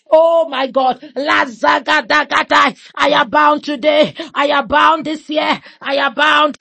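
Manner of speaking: ordinary talk, nothing marked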